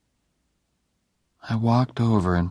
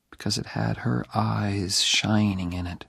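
ordinary delivery

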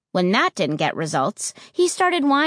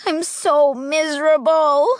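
miserable wail; 7 semitones higher, 4 dB louder than usual. A narrator's reading. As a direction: wail it out, pitched well up. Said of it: "I wailed".

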